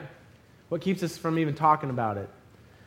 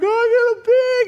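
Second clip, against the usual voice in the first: very high, loud gleeful yell; squealing triumphant shout; excited bragging; 8 dB louder than usual. Retold or imitated. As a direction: crow about a prize catch. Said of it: "And I'm excited".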